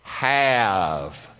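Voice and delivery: "Have" loud and long drawn out; emphatic "have". Shown as a demonstration. Preacher names his favorite word in the verse, "Have" loud and drawn out. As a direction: stretch "Have" out with force. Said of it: "my favorite word in that whole verse".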